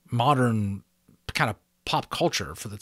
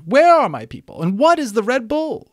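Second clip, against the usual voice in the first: much higher, louder cry; eager cry; 11 dB louder than usual. A narrator's reading. Unicorn crying out.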